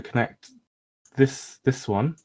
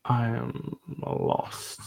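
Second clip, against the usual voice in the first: whispering; 7 dB quieter, much slower than usual. Real, not acted. Player stumped, mutters to self.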